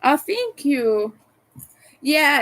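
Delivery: usual speaking voice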